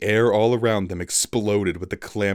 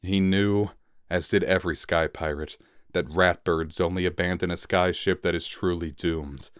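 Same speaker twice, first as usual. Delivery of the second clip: plain reading voice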